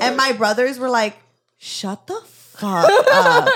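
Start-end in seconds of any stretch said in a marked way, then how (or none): 1.6-3.5 high, loud, drawn-out mock outrage; mock exasperated yell. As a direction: imitate fed-up brothers, stretching each word loudly. Brothers fed up with her accent.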